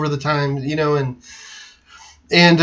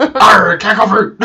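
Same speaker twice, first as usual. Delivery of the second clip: hammed-up pirate growl, louder and higher; mock pirate voice, a touch louder; pirate accent; 4 semitones higher, 6 dB louder, slower than usual. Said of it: "You can try it in a pirate accent".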